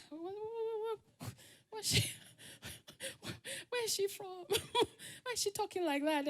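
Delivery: high, hushed giggly gossip; mocking classmates. Barrack kids laughing at the outsider, hushed mockery. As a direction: snicker it quietly behind someone's back. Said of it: "And they will laugh".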